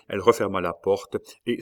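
ordinary delivery

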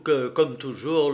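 ordinary delivery

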